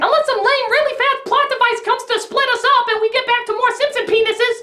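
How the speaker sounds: high-pitched put-on girl voice; earnest daughter. Daughter argues with her Penguin father, in high mock voice.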